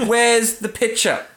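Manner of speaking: slow mock demand. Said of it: "we're looking at a picture right now".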